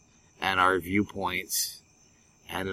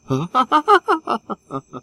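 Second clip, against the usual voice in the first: put-on old-timey laugh; 6 dB louder than usual. Laughing like the fifties.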